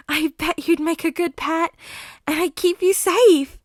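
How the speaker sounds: high, loud possessive croon; creepy flirty villain. Acted. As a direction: purr it with menace.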